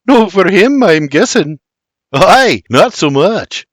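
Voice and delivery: plain reading voice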